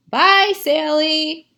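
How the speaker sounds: high, loud, slow call; the sheep calling goodbye across the pasture. Acted. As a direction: call it after her as she runs.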